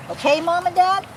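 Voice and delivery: usual speaking voice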